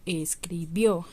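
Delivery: escribió slowed, landing hard on -BIÓ; es-cri-BIÓ, weight on the end; stress on final syllable. Shown as a demonstration. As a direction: sound out escribió, hit the final ó. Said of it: "al final".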